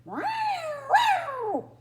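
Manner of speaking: high, loud wailing yowl; cat yowl. Acted. A cat stuck up a tree yowls, high and loud. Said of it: "the sound that echoed around".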